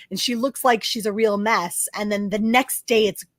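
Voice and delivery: usual speaking voice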